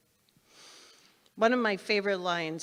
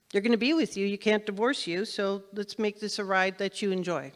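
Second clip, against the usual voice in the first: normal talking voice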